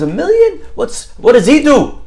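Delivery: high, slowed mock disbelief; hammed-up joke astonishment; shocked. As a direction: gasp out the question, far up in pitch. Buyer shocked by parrot price.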